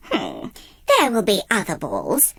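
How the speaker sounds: low, smug stepmother voice. A narrator's reading. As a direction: purr it with cold satisfaction. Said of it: "told her smugly".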